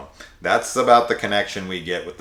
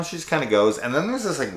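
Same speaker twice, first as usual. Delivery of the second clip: ordinary delivery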